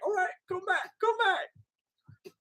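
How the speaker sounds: high, soft mock-inviting come back. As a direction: tease them to come back. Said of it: "I was like".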